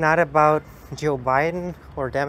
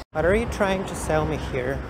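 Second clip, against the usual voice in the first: ordinary talk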